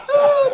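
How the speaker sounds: high-pitched imitated groan of effort; high mock wail. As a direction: wail "oh" like someone straining uphill. Imitates woman straining to push.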